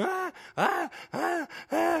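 high-pitched imitated wails; short, high mock screams, one after another; mock screaming. Retelling the rush to rescue the babies, high mock screaming. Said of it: "we'd be screaming".

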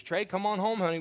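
imitated mother calling out; hollering. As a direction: call a child home from the window. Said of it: "Leslie hollers out the window".

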